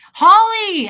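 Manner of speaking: loud, high delighted call; excited greeting. Real, not acted. Spotting a missed friend, excited loud greeting. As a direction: sing out the name in delight.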